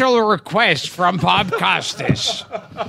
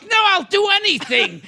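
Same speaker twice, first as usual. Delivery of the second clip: high, hammed-up desperate offer; pleading cry; 8 semitones higher than usual. Caught model bargaining with her boss, in a high desperate plea.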